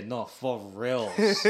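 "No, for reals" drawn out slowly with a laugh, "reals" rising; Pidgin reply. Shown as a demonstration. Answering a friend in Pidgin, slow and laughing. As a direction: stretch "reals" long and playful. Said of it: "and you say".

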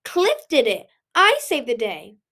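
indignant. Acted. Little Cliff claiming credit from tears, loud indignant protest. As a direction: insist hotly on the credit. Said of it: "indignation had broken through his distress".

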